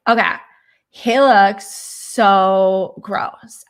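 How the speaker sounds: slow, stretched, loud mock disgust; mocking her. Mocking a streamer who calls a man gross. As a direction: drag out the disgust. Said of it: "she was just kinda like".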